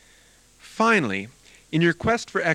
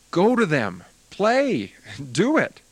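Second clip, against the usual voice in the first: higher, louder exhortation in short bursts; urging command; 5 semitones higher, 4 dB louder, slower than usual. Acted. Teacher urging a student to go and play, urging. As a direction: push them to get out and play.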